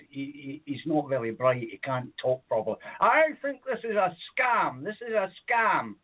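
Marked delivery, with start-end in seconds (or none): 3.0-5.9 indignant woman. Retold or imitated. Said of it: "woman calls it a scam".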